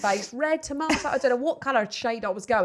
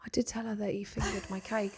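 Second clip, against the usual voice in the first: put-on small, low, hushed child voice; imitating heartbroken little boy; 4 semitones lower, 11 dB quieter than usual. Son explaining to mum he told teacher, hushed and crestfallen. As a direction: retell it softly as a crestfallen boy. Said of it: "it was just his little heart".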